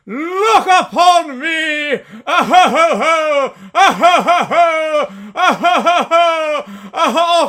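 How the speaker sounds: loud, high, hearty giant laughter; booming jolly laugh. Acted.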